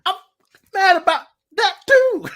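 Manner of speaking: loud, very high exasperation; comically furious protest, punching each word; mock outburst, voice shooting up; hammed-up anger. Acts out being mad.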